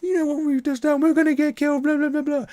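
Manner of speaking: high squeaky mock panic; frightened player character wailing that they will all die, in a high squeaky voice; panicked freakout. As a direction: play a freshly embraced vampire losing it, voice shooting way up. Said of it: "a full-on crash".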